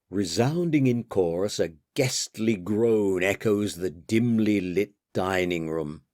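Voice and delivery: usual speaking voice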